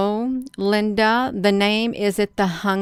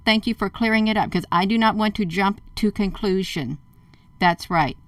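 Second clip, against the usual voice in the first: ordinary talk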